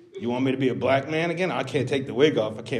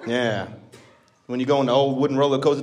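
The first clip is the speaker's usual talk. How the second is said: ordinary delivery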